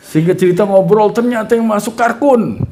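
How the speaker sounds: high, loud excited reveal. Real, not acted. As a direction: announce it with delight.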